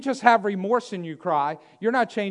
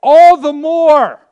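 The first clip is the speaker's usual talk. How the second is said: very loud, high, drawn-out preaching cry; emphatic shout; 7 semitones higher, 15 dB louder, slower than usual. Real, not acted. Preacher stressing the blind man's persistence, stretched-out shout. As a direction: belt it out slowly from the pulpit.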